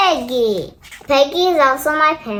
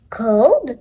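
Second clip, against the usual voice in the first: ordinary delivery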